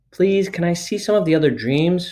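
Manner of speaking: put-on eager small girl asking; Sophie voice. A narrator's reading.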